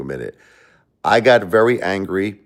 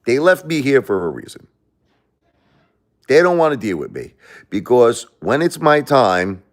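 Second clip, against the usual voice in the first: ordinary delivery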